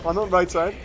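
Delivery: excited esports caster calling the fight; live play-by-play. Acted. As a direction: call every move like a live caster. Live teamfight commentary.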